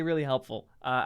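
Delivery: ordinary talk, nothing marked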